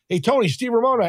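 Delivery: high, bright hello on the phone; excited phone greeting. Retold or imitated. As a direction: greet him eagerly. Calling old mentor on phone.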